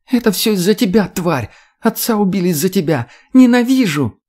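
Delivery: high-pitched hateful outburst; furious girl screaming. A narrator's reading. Daughter blames mother for father's death, furious scream. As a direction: scream the blame, peaking loud on the last word. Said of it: "закричала девушка из тамбура".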